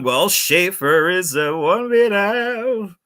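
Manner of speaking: mock retro radio beer ad; vintage beer commercial. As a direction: croon the old beer slogan. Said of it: "Can I remember a beer".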